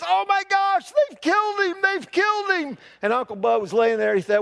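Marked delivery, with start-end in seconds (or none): 0.0-2.8 high, panicked woman's cry; frantic lady. Acted. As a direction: shriek it like a horrified bystander. Bystander lady sees crushed man.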